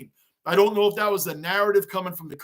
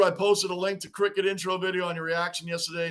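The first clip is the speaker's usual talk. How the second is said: ordinary talk, nothing marked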